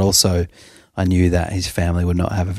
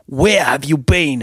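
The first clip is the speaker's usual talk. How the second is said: stern uncle; 7 semitones higher, slower than usual. Retold or imitated. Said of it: "he said to him".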